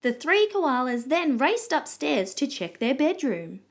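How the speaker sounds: ordinary delivery, nothing marked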